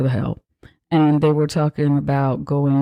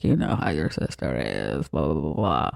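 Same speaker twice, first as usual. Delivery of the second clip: put-on a much lower, softer, bored boyfriend voice; 12 semitones lower, 6 dB quieter than usual. Boyfriend brushing off his girlfriend's sister worries, lower and bored. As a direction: drop low and brush it off lazily. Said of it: "and he's like".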